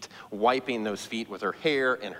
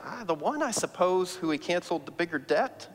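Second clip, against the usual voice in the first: higher, hesitant reply from Simon; a grudging guess, pitched up; Simon answering Jesus; 7 semitones higher than usual. Acted. Simon answers Jesus reluctantly, higher and hesitant.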